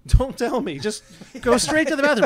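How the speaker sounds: high-pitched panicked-dad imitation; mock-frantic parental instruction; retold urgent order. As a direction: send the kid off urgently, pitched well up. Retold urgent order to sick kids.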